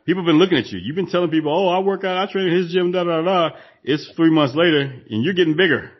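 teasing a no-show member, pitch up. Retold or imitated. Scolding a lazy gym member.

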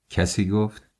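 ordinary delivery

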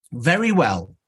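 normal talking voice

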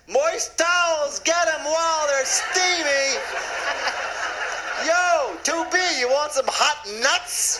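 rowdy flight attendant. Pretend. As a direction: bellow it like a ballpark vendor. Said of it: "It was like".

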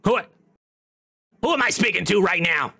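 rough stab at a famous comic's voice; Gilbert Gottfried. Acted. Stone asking who woke it, imitating Gottfried. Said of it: "sounds like Gilbert Godfrey".

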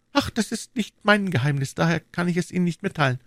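put-on young woman's voice, pitched very high; high-voiced lady confiding in a gallant; Madame Bonacieux. A narrator's reading.

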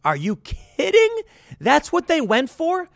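can't believe what the movie did; incredulous. Real, not acted. Laughing at the movie's ending.

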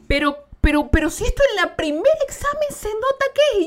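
doctora incrédula, mucho más aguda; voz alta y asombrada de la doctora; imitando a la doctora. Retold or imitated. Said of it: "Y me dicen". (la doctora, sorprendida, en voz aguda)

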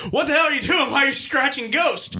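loud, high, disbelieving shout; incredulous yell. Retold or imitated. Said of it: "everyone was like".

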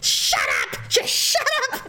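hammed-up, strained mock shout; retold snapping. Imitating panicked Daryl answering her, strained mock yell. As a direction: snap it back in exaggerated panic.

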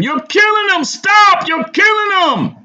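very high, loud alarmed scream; crowd screaming. A narrator's reading. Crowd to police choking a man, screaming. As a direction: scream desperately for them to stop. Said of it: "I could hear them scream".

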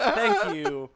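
laughing through the words. Real, not acted. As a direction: laugh out the thanks. Laughing thanks.

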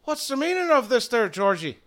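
lightly voiced Irish challenge; puzzled Irish redhead. Redhead asking druid what this means, puzzled.

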